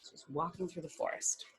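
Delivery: hushed storyteller whisper. Acted. Narrator whispering to children as Fiona walks. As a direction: whisper it as if not to disturb.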